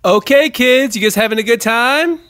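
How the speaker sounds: put-on high, upbeat kids' party host; rally a crowd of kids; kids' entertainer.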